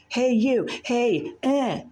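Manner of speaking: high yelled call from upstairs; retold shout. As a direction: shout it up the stairwell. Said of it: "started shouting at me".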